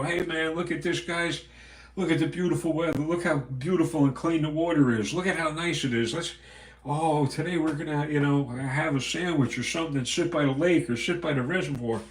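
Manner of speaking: lightly hammed-up outdoorsy host; mock fishing video host. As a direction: play a cheery fishing vlogger showing off the lake.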